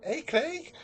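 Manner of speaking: high-pitched mock Liverpool accent. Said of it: "I was doing a Liverpool accent".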